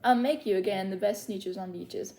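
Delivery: lively singsong read-aloud of a rhyming book; story reading to children. Acted. Librarian reads Seuss rhymes to little story timers, lively singsong. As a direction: read the rhymes to the kids with bounce.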